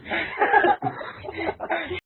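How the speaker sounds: airy laughter breaking out; breathy high laugh. Real, not acted. Laugh after monster line.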